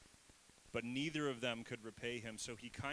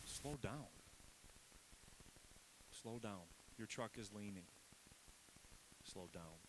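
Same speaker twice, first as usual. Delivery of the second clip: put-on calm a child down like a parent; parental; 3 semitones lower, 9 dB quieter than usual. God talking like a parent to Simon, softer. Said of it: "It's very parental".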